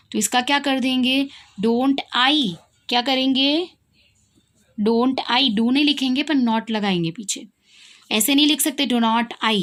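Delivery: ordinary talk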